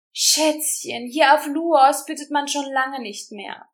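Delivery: high, loud sneering mockery; mock her begging. A narrator's reading. The leader mocking Rosa's plea, high sneering voice. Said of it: "spottete der Anführer".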